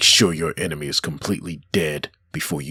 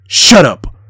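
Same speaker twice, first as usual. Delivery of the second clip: explosive furious shout; angry shout; 11 semitones higher, 14 dB louder than usual. Acted. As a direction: yell it, much higher and louder. Hero snaps at fanatic preacher.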